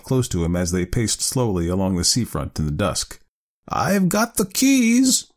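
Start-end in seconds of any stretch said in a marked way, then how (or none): 3.7-5.3 high, drawn-out aunt's announcement; slow, high-pitched delight tinged with awe; delighted, half awed. A narrator's reading. Aunt revealing the keys to her nephew, delighted and awed. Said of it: "delighted yet half awesome voice".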